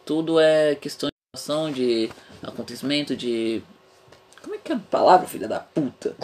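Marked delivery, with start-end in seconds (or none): 4.4-6.2 laughing. Real, not acted. Laugh heard after curse.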